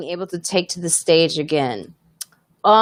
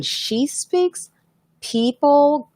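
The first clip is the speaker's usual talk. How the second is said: slow, higher, self-admittedly bad Irish accent; 8 semitones higher, much slower than usual. Telling the chat about Lois's charm in a slow, bad Irish accent. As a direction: drag it out in a rough Irish accent, pitched up. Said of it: "I know I'm doing her accent really bad".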